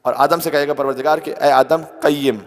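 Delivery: a loud preacher's call to Adam; loud sermon call. Real, not acted. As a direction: call out with full force. Preaching at full force. (بلند آواز میں پکارنا)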